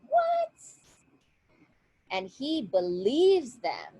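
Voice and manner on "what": very high-pitched shocked "what". Acted. Man hearing rumors on the beach, shocked. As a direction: squeak it out in disbelief.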